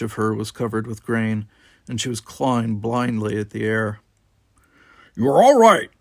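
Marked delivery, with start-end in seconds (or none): 5.2-5.9 loud, high call to cut through panic. Acted. Worf calling to a panicked Tanya, loud and firm. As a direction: shout it over the chaos. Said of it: "he called to her".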